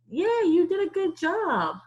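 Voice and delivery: high, loud aunt voice; great aunt's praise. Retold or imitated. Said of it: "until she said".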